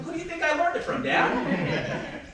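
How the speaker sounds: high, quick retort with laughter in it; retold yelling back. Grown child answering dad at the computer, retold yelling back. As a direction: fire it back in mock indignation. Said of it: "I started yelling at him".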